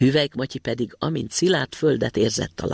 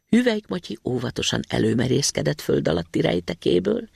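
ordinary delivery, nothing marked

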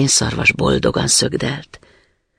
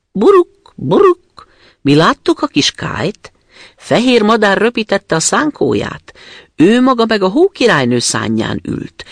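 cooing wood pigeons; 8 semitones higher, 4 dB louder than usual. Acted. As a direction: coo like a sad wood pigeon telling its tale. Wood pigeons tell about Kay. (magas, búgó vadgalamb hang)

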